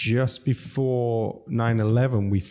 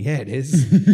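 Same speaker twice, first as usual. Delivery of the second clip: laughing; 4 semitones higher, 8 dB louder, slower than usual. Retold or imitated. Retold reply, laughing.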